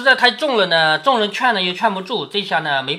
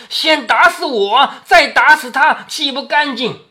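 slow, higher and louder, quavering; old matriarch cutting him off with a shaky cry; trembling old grandmother; 5 semitones higher, 6 dB louder, slower than usual. Retold or imitated. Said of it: "所以颤巍巍的声音说".

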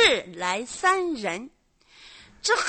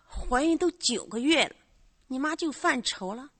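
ordinary talk

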